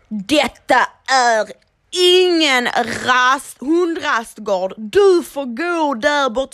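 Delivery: loud, slow, mocking grumpy old neighbour; grumpy old man. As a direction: boom it out like a bossy old man. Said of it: "Han bara". (gnällig gammal gubbe som skäller)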